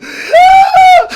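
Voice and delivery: very high loud scream of panic; panicked scream. Retold or imitated. Boy screaming during snake bite, very high panicked scream. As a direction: shriek in terror. Panic attack while bitten.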